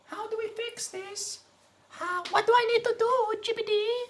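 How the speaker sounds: mock sweet falsetto. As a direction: sweet-talk the chatbot in falsetto. Mock voice to chatbot.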